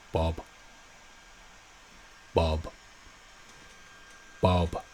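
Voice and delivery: low, soft deadpan bob sound; fish saying bob. Pretend. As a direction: mimic a fish bobbing. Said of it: "Terrible joke, fish".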